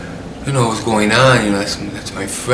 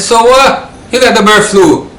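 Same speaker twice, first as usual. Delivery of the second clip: loud, high-pitched incredulous retort; re-enacted dismissive yell; scornful outburst; 13 semitones higher, 7 dB louder than usual. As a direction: throw it back at a friend, loud and dismissive. Acted scornful retort to friend.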